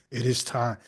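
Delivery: low, soft imitation of a deep-voiced preacher. Host mimicking the man of God on stage, low and soft. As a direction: drop the pitch and hush it.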